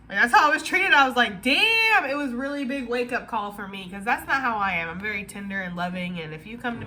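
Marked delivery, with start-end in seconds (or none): 1.4-2.0 stunned damn. Retold or imitated. Her shocked reaction to treatment.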